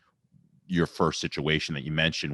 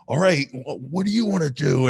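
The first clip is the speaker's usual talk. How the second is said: mock challenge; 9 semitones higher, 4 dB louder than usual. Voicing the pressure to fight.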